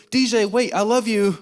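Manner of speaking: high, hammed-up begging; mock desperate plea. Pleads with fleeing date, retold.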